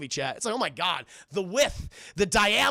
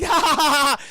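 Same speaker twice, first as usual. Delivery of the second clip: loud, high stage laugh; exaggerated imitated laughter; mock hearty laugh; 8 semitones higher, 10 dB louder than usual. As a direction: belt out a villain's ha-ha. Jack's retold laugh.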